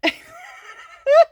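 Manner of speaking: very high, very loud shout of laughter; shrieking laugh. Real, not acted. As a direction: explode into laughter at the photo. Bursts out at her photo.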